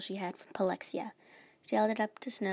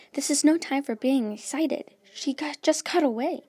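loud, raised-pitch reproach; exasperated scolding; 5 semitones higher, 7 dB louder than usual. A narrator's reading. Luna rebukes the smiling queens, loudly exasperated. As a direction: scold them loudly for celebrating.

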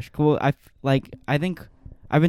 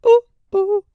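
mock squeal; 9 dB louder than usual. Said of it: "mock self-praise squeal".

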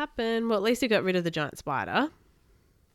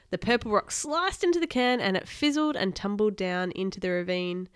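ordinary delivery, nothing marked

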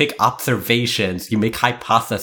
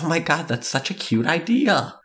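gushing impression; 9 semitones higher than usual. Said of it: "They would be like".

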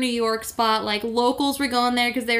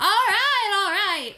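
high, exasperated "all right", falling; a henpecked husband caving, higher; husband grumbling back at his wife in a higher voice; weary husband giving in; 8 semitones higher, slower than usual. Retold or imitated. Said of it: "And he was like".

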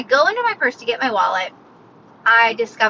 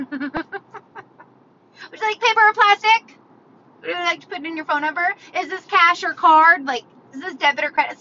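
higher-pitched string of checkout questions; mock cashier; 5 semitones higher than usual. Cashier firing routine questions at a walletless customer, higher mock voice. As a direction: parody a cashier rattling through the script.

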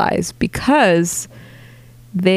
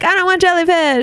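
whiny picky eater; 9 semitones higher than usual. Retold or imitated. Same picky person quote.

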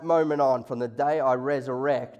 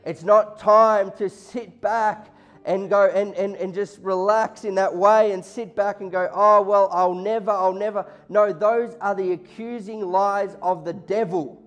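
loud, higher preaching; preaching at full force; 4 semitones higher, 6 dB louder than usual. Acted. Pastor urging the church, loud high preaching. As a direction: drive it home to the room.

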